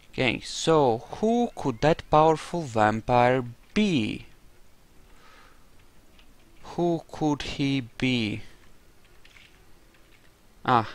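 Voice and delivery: normal talking voice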